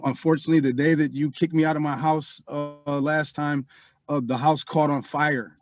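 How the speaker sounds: usual speaking voice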